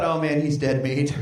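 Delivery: low, doomed aside; comic dread. Retold or imitated. Dad realizing his son will be teased, low mock dread. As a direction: sink deep as if all is lost. Dad's inner dread, for laughs.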